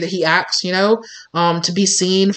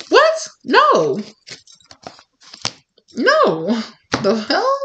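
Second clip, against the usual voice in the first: much higher, shocked no; startled; 8 semitones higher, much slower than usual. Real, not acted. Reader reacting to a card, high and shocked. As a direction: recoil at something unexpected.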